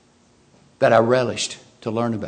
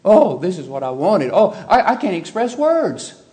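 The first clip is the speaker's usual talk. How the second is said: mock delight; 5 semitones higher, 3 dB louder than usual. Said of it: "praising her in chapter two".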